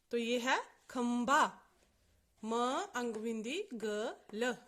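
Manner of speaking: ordinary talk, nothing marked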